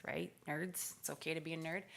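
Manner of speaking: ordinary talk, nothing marked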